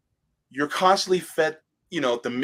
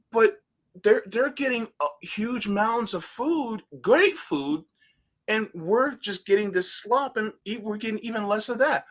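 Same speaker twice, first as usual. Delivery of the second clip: put-on whiny kid complaint; 9 semitones higher than usual. Kid complaining about portions.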